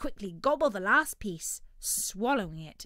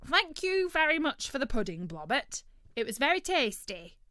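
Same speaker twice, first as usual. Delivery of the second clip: loud, high bird voice; greedy turkey, politely thanking; 4 semitones higher, 6 dB louder than usual. Acted.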